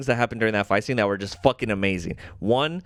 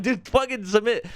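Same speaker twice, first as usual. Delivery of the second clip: much higher, mock-exasperated urging; exasperated plea; 11 semitones higher than usual. Host retells urging the Watcher to give up, high exasperation. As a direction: plead in disbelief at a stubborn fighter.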